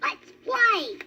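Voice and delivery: high little rabbit asking; baby rabbit voice. A narrator's reading. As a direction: voice a small eager bunny. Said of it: "said Thumper".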